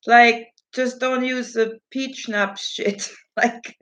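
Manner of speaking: usual speaking voice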